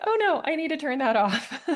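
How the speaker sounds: high-pitched little "oh no" moment, retold with amusement; mock alarm. Host explaining the dinging texts to viewers, mildly alarmed. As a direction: retell a small panic, ending in a laugh. Reacting to dinging text messages.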